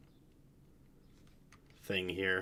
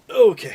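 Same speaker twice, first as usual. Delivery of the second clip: loud, high pained cry; pained yell; 12 semitones higher, 19 dB louder than usual. Real, not acted. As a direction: cry out in pain. Taking foot out, pain.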